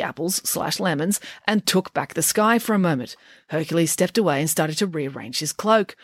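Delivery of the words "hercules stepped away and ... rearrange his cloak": ordinary delivery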